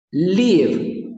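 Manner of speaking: "Leave" alto, vocal larga; lip-reading demo. Shown as a demonstration. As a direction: estira la i de "Leave". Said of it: "observe mis labios". ("Leave" exagerado con los labios)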